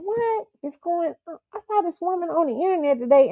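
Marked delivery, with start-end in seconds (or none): 0.0-1.4 bewildered. Retold or imitated. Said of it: "I'm going".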